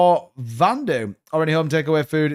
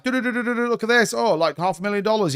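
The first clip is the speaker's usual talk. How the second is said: mocking show-off YouTuber; 6 semitones higher than usual. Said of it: "And they're like".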